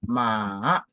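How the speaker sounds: "ma" dipping low, then rising; long low dip on "ma"; third tone. Shown as a demonstration. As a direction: sink on "ma", then swing it upward. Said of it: "le troisième ton".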